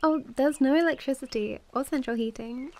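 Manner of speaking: ordinary talk, nothing marked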